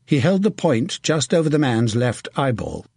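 ordinary delivery

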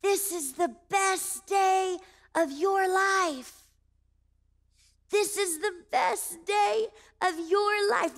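high, slow, stretched-out call; drawn-out high mock shout; retold shouting. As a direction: imitate strangers calling out word by word. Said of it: "they started to shout at me".

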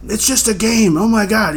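high-pitched mock protest from dad; imitated father, high and exasperated. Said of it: "he's trying to back me up".

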